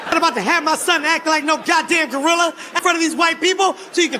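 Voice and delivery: comic angry-dad refusal; outraged father. Retold or imitated. Acted outraged father confronting guide.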